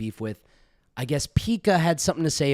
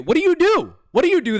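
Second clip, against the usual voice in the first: high, loud challenge; mock shout; 14 semitones higher, 5 dB louder, faster than usual. Streamer mockingly yells back at the ranter, loud and high. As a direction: yell it at him in mock outrage.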